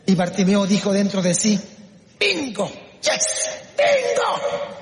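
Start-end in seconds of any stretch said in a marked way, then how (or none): 2.2-4.7 high, drawn-out cheer; exultant cry. Acted. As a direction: celebrate a lucky break, stretching it out high. Bartimeo celebra la llegada de Jesús. (grito de júbilo)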